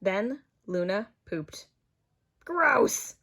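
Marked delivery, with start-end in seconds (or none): 2.4-3.2 higher, sharp cry of gross; a kid yelping in disgust; disgusted exclaim. Acted. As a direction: recoil at the dog poop. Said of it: "exclaimed Kate".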